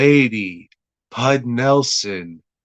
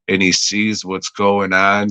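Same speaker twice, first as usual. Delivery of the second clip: normal talking voice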